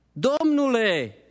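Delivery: high, drawn-out plea to the gardener; high, slow, imploring "Domnule"; pleading Maria. Acted. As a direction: call out slowly like a grieving woman. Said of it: "i-a spus Maria".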